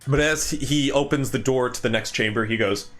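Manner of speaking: ordinary delivery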